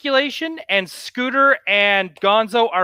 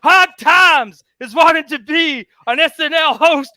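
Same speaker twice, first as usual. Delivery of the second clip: loud, higher wrestling promo rant; Dusty Rhodes promo; 5 semitones higher, 4 dB louder than usual. Retold or imitated. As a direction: shout it like a wrestler's hard times promo. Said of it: "cutting a Dusty Rhodes Hard Times promo".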